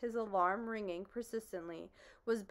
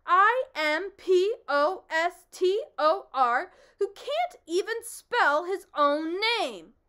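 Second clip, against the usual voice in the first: loud, high excited outburst; excited shout; 10 semitones higher, 12 dB louder, slower than usual. Acted. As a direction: shout the accusation in a frenzy. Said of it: "shouted the bee very excitedly".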